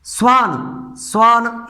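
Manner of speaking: स्वान opened directly on "s", no "i" before it; plain "s" onset. Shown as a demonstration. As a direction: say स्वान as "swaan". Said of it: "सा हो रहा है".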